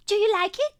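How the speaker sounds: put-on high, loud toddler question; a small child waiting for praise; eager little girl. A narrator's reading. Little girl asking her father about cookies, eager.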